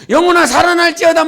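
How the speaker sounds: loud, high demonstration of the chant. Shown as a demonstration. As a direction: show how it was shouted. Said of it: "막 세 번 외쳤어". (크고 높게 외치는 선포 시범)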